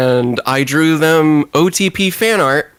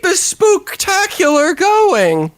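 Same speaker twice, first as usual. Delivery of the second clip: much higher playful cheer; hype cheer; slower than usual. Pretend. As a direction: egg her on like a spooky host. Cheering on the hesitant storyteller.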